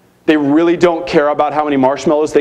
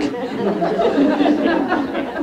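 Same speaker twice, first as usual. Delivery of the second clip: soft unvoiced laugh; a quiet airy chuckle; breathy laugh; 12 semitones higher, 5 dB quieter than usual. Real, not acted. Speaker laughing at Catholics searching the Bible.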